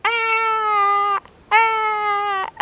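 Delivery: high, drawn-out mock scream; imitated distant kid scream. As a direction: echo a child's screams from far off. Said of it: "of Kyla screaming".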